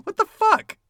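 squeaky high mock outrage; a hammed-up high-pitched what the fuck; imitating Chu. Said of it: "is Lady Chu right now saying".

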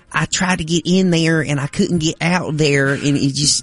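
mock Alabama good ol' boy accent; Deep South accent. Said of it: "but the accent, I'm telling you".

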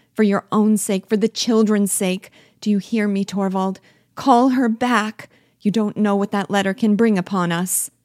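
desperate pleading. Acted. Wife begs her husband to recall the letter, desperate and pleading. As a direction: plead as if everything hangs on it.

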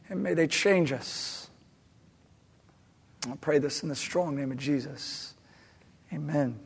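soft, earnest pastor's prayer. Acted. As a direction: pray quietly and sincerely. Leads a prayer.

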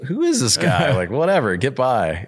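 high, mock-exasperated brush-off; annoyed inner reaction. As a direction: play your own irritated younger self waving him past. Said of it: "And I'm like".

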